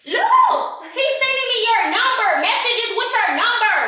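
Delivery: very high, heated accusation; staged outrage. Pretend. Prankster showing partner the messages, high-pitched outrage. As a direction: wail it out like damning evidence.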